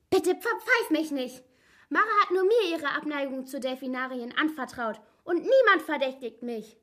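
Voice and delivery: high, squeaky pleading. A narrator's reading. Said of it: "Diese piepsige Stimme". (piepsig flehende Stimme)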